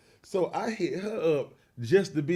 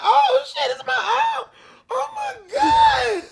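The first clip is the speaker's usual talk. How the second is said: much higher, loud, slow, breaking into a cry; retold freak-out; 8 dB louder, slower than usual. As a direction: lose it completely over the eye. Touching his eye hanging out.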